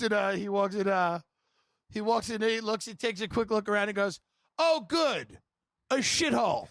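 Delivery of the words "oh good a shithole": high, sneering put-down of the room; mock disdain on entering the party; sneering quip. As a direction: toss off a sneer as you walk in. Howie at party quoted.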